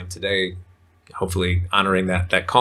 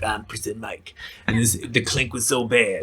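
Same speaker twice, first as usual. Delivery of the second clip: lightly voiced gruff jailbird act, pitched a bit up; Prison Mike impression; 3 semitones higher than usual. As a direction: imitate Michael Scott playing a tough ex-con. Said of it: "using the Prison Mike accent".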